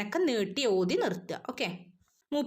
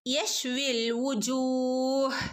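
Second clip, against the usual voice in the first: slow chanted recitation; tajweed recitation; 3 semitones higher, much slower than usual. Shown as a demonstration. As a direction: recite യശ്വിൽ വുജൂഹ് slowly with half sukun. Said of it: "half സുക്കൂന് കൊടുക്കുക". (സാവധാനം ഓതുക)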